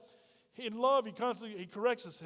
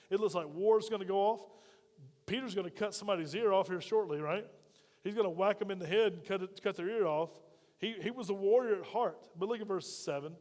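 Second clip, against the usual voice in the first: ordinary talk, nothing marked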